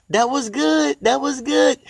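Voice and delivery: a hint of Oprah relishing the moment; light, high, admiring Oprah echo; Oprah impression. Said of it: "Like Oprah say".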